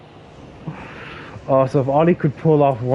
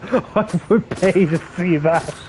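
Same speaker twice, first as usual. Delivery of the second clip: slow, laughing through the words; 6 semitones higher, slower than usual. Real, not acted. Picturing Aster Phoenix with an Irish accent, laughing. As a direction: laugh helplessly while getting it out.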